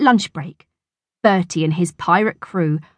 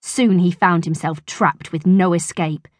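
plain reading voice

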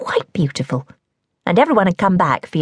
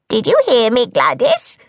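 hag calling out; 6 semitones higher than usual. A narrator's reading. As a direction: call down to the stubborn toad. The hag asks the toad.

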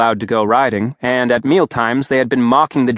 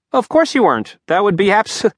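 higher, pointed mock agreement; sarcastic outrage, pitched up; indignant sarcasm; 9 semitones higher than usual. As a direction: cut off mid-word in indignation.

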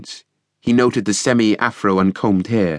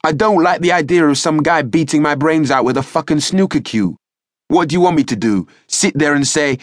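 shouting match; 7 semitones higher than usual. A narrator's reading. Youth outshouting the police sergeant, angry yelling. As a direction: yell him down, outshout him. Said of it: "compete in the shouting match".

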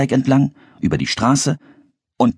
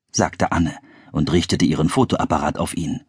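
plain reading voice

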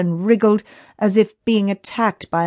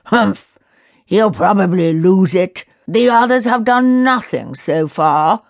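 louder, dismissive scoff; stubborn grumble; 4 dB louder than usual. A narrator's reading. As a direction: scoff and refuse to budge. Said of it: "stubbornly sticking to his ideas".

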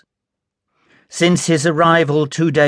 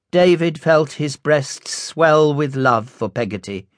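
plain reading voice

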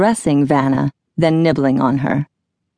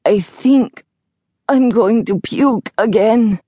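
higher, slowing sickly voice; nauseous; 6 semitones higher, 3 dB louder, slower than usual. Acted. Sick Laszlo warns Jack, queasy and miserable. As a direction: drag the words out as if about to vomit.

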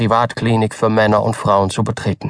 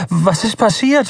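high, urgent question; anxious father; 10 semitones higher than usual. A narrator's reading. Nervous father-to-be presses the doctor, high and urgent. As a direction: blurt it out nervously. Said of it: "Reden Sie vernünftig".

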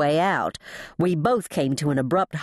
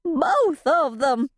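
high, drawn-out cry; panicked, stretched high exclamation; trembling distress; 11 semitones higher, slower than usual. A narrator's reading. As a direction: cry it out in despair. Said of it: "breath rasped in my throat as I trembled".

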